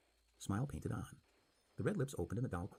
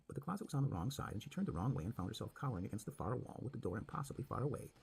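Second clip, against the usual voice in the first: plain reading voice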